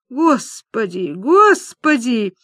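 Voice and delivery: slow, drawn-out exasperated moan. Acted. Лиза despairs at her friend's rambling, moaning. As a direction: groan each word out slowly, rising on the repeat. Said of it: "застонала Лиза".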